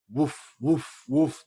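loud puffed "whoof", three times over; punchy exhaled whoofs of awe; crowd's "whoof". Retold or imitated. Imagining a crowd reacting to the knockout, loud whoofs.

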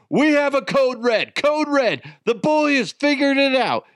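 high-pitched panicked call upstairs; urgent, high comic distress call; mock emergency alarm. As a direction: sound the alarm to your wife, hammed up. Calls wife upstairs in mock alarm.

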